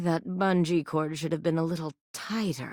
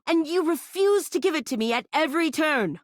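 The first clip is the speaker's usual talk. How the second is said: higher, wounded and accusing; pitched-up wounded complaint; hurt outburst; 10 semitones higher than usual. Acted. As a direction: throw the hurt back at them. Hurt character lashing out at player.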